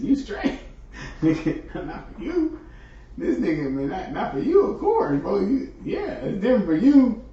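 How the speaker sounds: high-pitched teasing through laughter; laughing. Real, not acted. Streamer tells Rashad it's different for him, laughing. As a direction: crack up while reassuring a friend.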